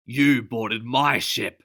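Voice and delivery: loud, slow accusation; captain barking in anger; barked. Acted. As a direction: bark it at him. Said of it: "she barked".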